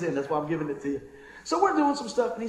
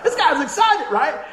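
excited; 7 semitones higher, 9 dB louder, slower than usual. Real, not acted. Describing the excited young man.

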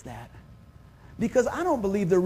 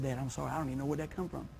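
sheepish apology; 5 semitones lower, 11 dB quieter, faster than usual. Retold or imitated. Son apologizing to scolding dad.

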